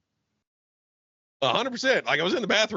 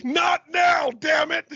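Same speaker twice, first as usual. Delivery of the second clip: angry dad shout; 12 semitones higher, slower than usual. Acted. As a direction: snap at the kid in the back. Acted dad yelling at kid.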